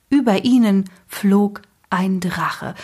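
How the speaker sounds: ordinary delivery, nothing marked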